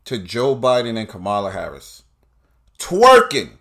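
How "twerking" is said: higher and louder, disbelieving; incredulous outburst. Real, not acted. Comic repeating the word in loud disbelief. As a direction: throw the word back in disbelief.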